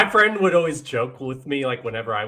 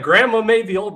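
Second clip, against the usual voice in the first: high, loud startled cry; scandalized grandchild; shocked exclaim; 9 semitones higher, 7 dB louder than usual. Retold or imitated. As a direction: blurt it out in disbelief. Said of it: "I was like".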